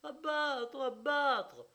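high, loud frantic cry; unbroken cries. Acted. Wife crying out without stop, high frantic shout. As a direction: keep crying it out. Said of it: "les cris ininterrompus".

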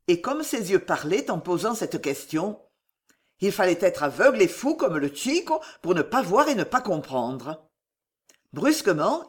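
plain reading voice

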